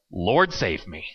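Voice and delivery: normal talking voice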